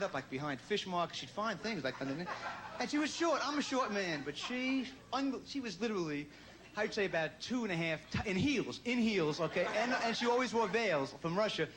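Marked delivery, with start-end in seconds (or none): none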